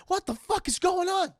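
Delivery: high-pitched mock panic; imitated alarmed outcry; acted panic.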